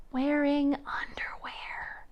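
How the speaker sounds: breathy whisper, slowed right down; a slow, secret whisper; hushed whisper. Acted. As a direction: whisper it so the bear won't hear. Bear-in-underwear question, hushed.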